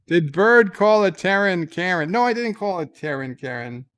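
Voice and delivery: ordinary talk, nothing marked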